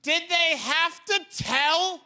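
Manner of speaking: incredulous rant. Acted. Comic to audience about telling a gorilla bad news, incredulous rant. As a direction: protest it way up high, hammering the words.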